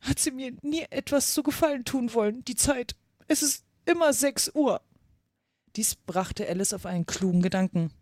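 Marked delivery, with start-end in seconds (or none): 0.0-4.8 high, plaintive Hatter voice; sad. A narrator's reading. Hatter complaining to Alice about Time, sad. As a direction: lament it with mild self-pity. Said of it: "sprach der Hutmacher traurig weiter".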